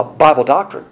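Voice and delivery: ordinary delivery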